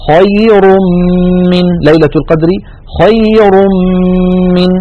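خير من recited as "khayrum-min" with a held, nasal doubled mim; chanted خير من with a nasal mim held long; tanween merged into mim. Shown as a demonstration. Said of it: "انتقلنا من الراء المضمومة إلى الميم".

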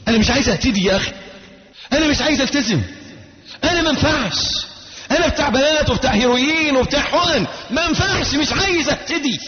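high, crying shout of despair; weeping youth's outcry. Retold or imitated. Preacher voicing the sobbing young man in the mosque, anguished shout. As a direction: wail it out like a broken young addict. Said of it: "يبكي وينتحب".

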